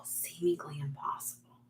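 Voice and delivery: soft, hushed echo of the voices she heard. Retold or imitated. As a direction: breathe it like a distant spirit voice. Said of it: "they kept saying".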